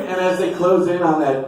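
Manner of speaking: ordinary delivery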